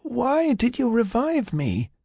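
slow, very high, frail question; weak, confused patient. Acted. Patient asks why she was revived, slow frail voice.

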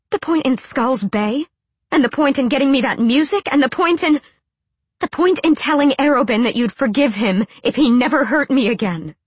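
high, loud rushed questions; frantic. A narrator's reading. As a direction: pile them on frantically. Frantic listing of grievances.

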